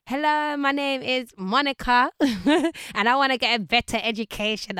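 loud, high, over-the-top self-introduction; hammed-up formal introduction, loud and high; doing the most. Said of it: "I was just doing the most".

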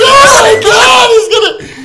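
very high, loud hammed-up wail; retold sobbing shriek, played for laughs; mock bawling. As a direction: imitate a panicked kid bawling at full volume. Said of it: "I just started bawling".

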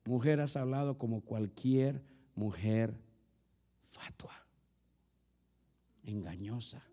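low, quiet and slow, fading almost to a whisper; Job's solemn reproach to his wife; grave rebuke. Acted. Job answers his wife. (reproche grave y susurrado)

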